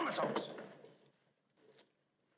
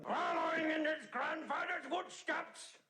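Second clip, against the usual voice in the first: shouted proclamation; 8 semitones higher than usual. Acted. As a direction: announce it like a booming declaration. Repeats phrase, first one shouted.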